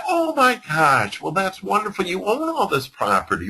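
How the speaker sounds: high, thrilled and fawning; gushing agents. Retold or imitated. Agents fawning over the landowner, high and thrilled. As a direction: gush over the big landowner.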